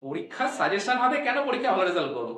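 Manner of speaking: usual speaking voice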